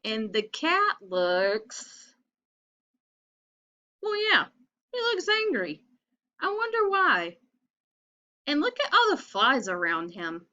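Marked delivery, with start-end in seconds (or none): 4.0-7.4 high mock-grumpy cat voice; cat's voice. Teacher voicing the grumpy cat for children, high and mock-grumpy. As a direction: play the cross cat, pitched up.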